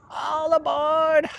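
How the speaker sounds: high, drawn-out rock-singer cry, then cackle. Acted. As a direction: wail it out slowly like a rock frontman. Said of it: "you put Aussie like [name]".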